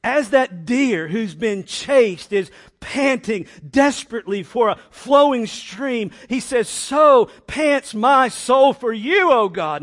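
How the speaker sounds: loud, high, urgent preaching; full-force impassioned reading; desperate scripture reading. Shown as a demonstration. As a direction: cry out the verse in desperation. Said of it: "So read it that way".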